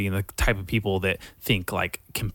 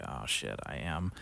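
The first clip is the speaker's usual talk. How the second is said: caught-out mutter; 6 semitones lower, 11 dB quieter than usual. Retold or imitated. Caught bluffing.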